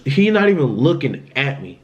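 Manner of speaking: ordinary talk, nothing marked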